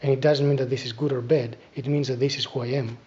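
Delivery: usual speaking voice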